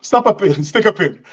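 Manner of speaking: ordinary talk, nothing marked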